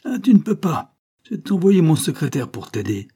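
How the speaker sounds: high, loud sarcastic threat; menacing mockery. A narrator's reading. Banquiste about to raise his stick, menacing mockery. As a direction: throw the child's words back angrily.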